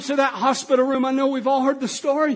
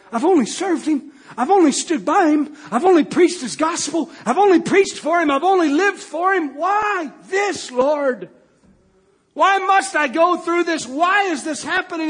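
insistent plea building in intensity; impassioned, rising lament; anguished protest; 5 semitones higher, 4 dB louder than usual. Retold or imitated. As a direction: cry out in protest to God. Anguished prophet questioning God.

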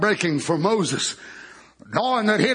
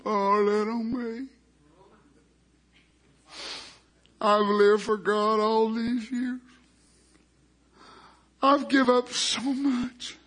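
mock self-pity; 4 dB quieter, much slower than usual. Imitating a believer pouting on a stool, mock self-pity. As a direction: sulk it out slowly, feeling sorry for yourself. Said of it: "if I didn't, some of us would".

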